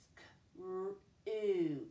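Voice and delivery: S and K as bare voiceless sounds, then R and OO; four separate sounds for SCREW; sounded out. Shown as a demonstration. Teacher counting four sounds in a syllable, sounded out. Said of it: "four sounds".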